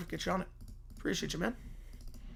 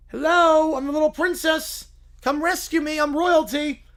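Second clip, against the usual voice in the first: put-on high, loud, bratty kid voices; whiny spoiled kids; 7 semitones higher, 8 dB louder than usual. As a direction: act out the kids demanding to be rescued. Kids complain, princess demands rescue.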